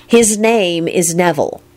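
slow, pointed correction; irritated and deliberate; irritable. A narrator's reading. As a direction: correct him testily, word by word. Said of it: "she replied irritably".

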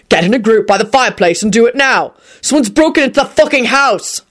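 tense, insistent command; urgent angry order. Acted. Quentin warns of a break-in, urgent angry order. Shouted order about intruders.

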